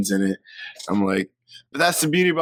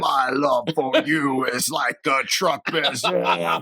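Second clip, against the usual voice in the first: slow, put-on Russian accent, a bit higher; 5 semitones higher, slower than usual. Friend asked to sing Berserker, in a slow Russian accent. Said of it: "do I have to do the Russian voice?".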